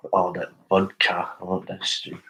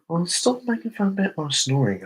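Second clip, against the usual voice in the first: mock whiny complaint; 12 semitones higher, 3 dB louder than usual.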